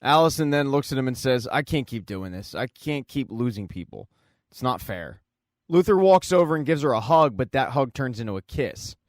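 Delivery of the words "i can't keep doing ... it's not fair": low, soft retold plea; understated despair. Allison admits to Luther she can't go on, understated despair. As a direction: quietly voice a worn-down confession.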